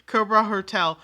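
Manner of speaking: "Crowbar" punched loud and firm; loud correction. Real, not acted. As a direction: fix the name, hitting "Crowbar". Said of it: "Crowbar jail raccoon".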